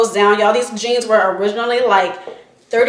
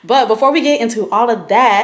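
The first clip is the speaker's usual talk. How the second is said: ordinary talk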